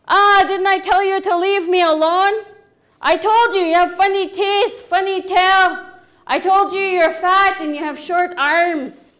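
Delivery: loud, high-pitched telling-off; angry scolding. Acted. Frog Woman rejecting Beaver, loud angry scolding. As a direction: lay into an unwanted visitor.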